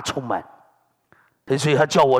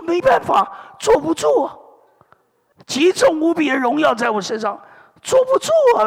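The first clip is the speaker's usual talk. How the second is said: excited protest; 10 semitones higher than usual. Retold or imitated. To the church elder, explaining why he keeps falling: high excited protest. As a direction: cry out that you just can't sit still.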